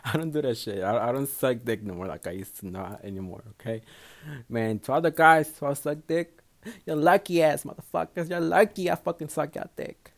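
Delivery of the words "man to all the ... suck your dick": raised-pitch, loud proclamation; loud, high boast to an audience; loud callout. Real, not acted. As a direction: call out emphatically to all of them. Mock address to past partners.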